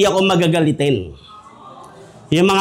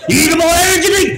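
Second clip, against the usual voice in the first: higher, fast, hammed-up hype; mock pumped-up boast; 10 semitones higher, faster than usual. Preacher mocking men who drink energy drinks, hammed-up boast. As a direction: puff it up like a mock brag.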